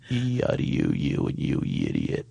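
very low, voiceless mumble; sister's code voice. Retold or imitated. Talking to his sister in their secret code, low grunt. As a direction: grunt it barely moving the jaw. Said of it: "first thing I'll hear on my answer machine".

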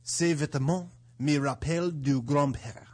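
sultry French accent phrase. Shown as a demonstration. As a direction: say it like a French film line. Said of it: "phrases that sound good in French".

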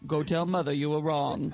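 a deep stern radio-play father. Shown as a demonstration. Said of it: "That was the father".

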